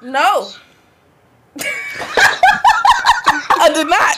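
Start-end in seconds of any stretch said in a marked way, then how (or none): 1.5-3.5 very high burst of laughter; shrieking laugh. Real, not acted. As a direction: let out a squealing laugh. Laughing at her answer.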